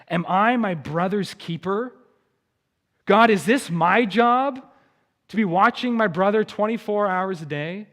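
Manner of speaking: indignant, lightly sneering retort; Cain's bitter sneer. Acted. Cain retorting to God about his brother, bitterly sneering. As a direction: throw it back with defiant emphasis. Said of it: "there's a bitter sneer in Cain's voice".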